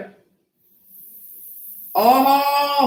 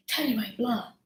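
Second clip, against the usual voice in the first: softer mumbled repeat of "I ain't blonde"; 10 dB quieter than usual. Real, not acted. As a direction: drop the voice and tuck "I ain't blonde" away to yourself. Repeats denial, softer take.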